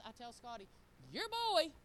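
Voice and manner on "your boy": loud, high disowning outburst; upset parent. Retold or imitated. Mother complaining to Scotty about Travis, loudly exasperated. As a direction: throw it out in mock exasperation. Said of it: "Whenever I get upset with Travis".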